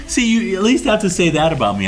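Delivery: usual speaking voice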